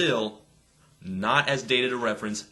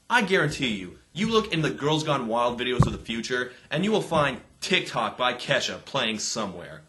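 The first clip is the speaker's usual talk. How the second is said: ordinary talk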